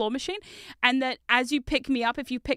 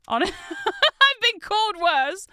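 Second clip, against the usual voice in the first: laughing; 9 semitones higher, 5 dB louder, much slower than usual. Real, not acted. Joking about insults.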